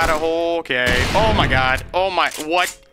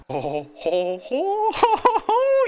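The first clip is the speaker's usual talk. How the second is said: mock laugh; slower than usual. Real, not acted. Mock laugh, pitch jumping.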